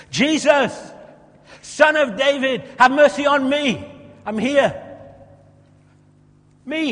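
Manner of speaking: loud, high pleading shout; shouting again. Acted. Blind beggar yelling to Jesus over the crowd, pleading.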